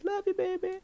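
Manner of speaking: very high-pitched affectionate aside; playful sweet talk. Real, not acted. Husband thanking wife who stayed home with the kids, playfully sweet. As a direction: coo it sweetly to a partner.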